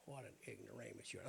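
low mocking devil taunting a fool. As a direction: sneer at him like the old devil. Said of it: "the old devil's up over here".

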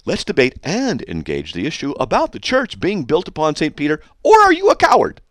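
mock taunt. Reading the critic's taunt aloud in a mocking voice. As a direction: sneer out the challenge.

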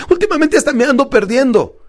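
high-pitched imitated lament; client's complaint. Client confessing to her coach, complaining. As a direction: imitate a client bemoaning a lapse.

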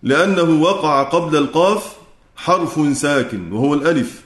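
normal talking voice

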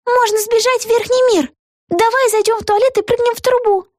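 put-on high-pitched girlish exclamation; exclaiming. A narrator's reading. Vega proposing escape to her friend, high girlish exclaiming. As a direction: blurt out the plan in a high little-girl voice. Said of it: "воскликнула Вега".

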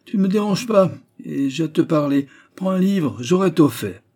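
high, loud insistence; urgent exclamation. A narrator's reading. Old man keeping Antoine upstairs, exclaimed insistently. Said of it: "s'écria-t-il".

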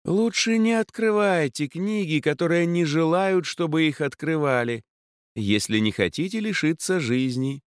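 put-on eerie, lofty old mage; ominous mage warning. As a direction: spook her with a creepy warning.

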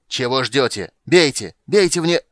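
furious shout to shoot; barking order. A narrator's reading. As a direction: bark it out loud and high. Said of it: "рявкнул лесничий". (яростный рык)

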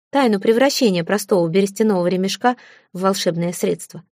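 plain reading voice

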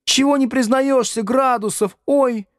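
put-on very high-pitched girlish clamour; girls shouting. A narrator's reading. Girls press Градусов to own up, shouting high. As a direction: squeal it accusingly at a classmate. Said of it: "закричали девицы".

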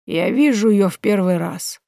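slow, detached and unmoved; slow, indifferent old woman. Acted. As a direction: say it as if nothing touches her. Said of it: "не выходя из состояния полного безразличия".

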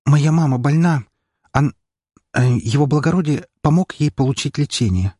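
tearful young maid, acted with some restraint; close to tears. A narrator's reading. Maid telling how the baron saved her sick mother, close to tears. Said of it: "она сейчас расплачется".

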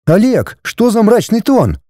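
high-pitched indignant wife. A narrator's reading. Wife objecting to husband's gloomy tone, indignant. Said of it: "возмутилась жена".

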